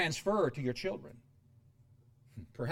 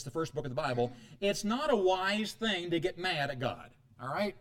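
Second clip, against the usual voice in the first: ordinary talk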